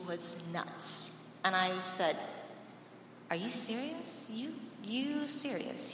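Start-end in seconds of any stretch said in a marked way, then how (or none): 3.3-4.3 pitched up, amazed at the question; incredulous. Retold or imitated. Daughter answering her dad, higher and incredulous. 4.3-5.9 higher, slower, drawn-out disbelief. Retold or imitated. Daughter repeating to her dad, slow and stunned.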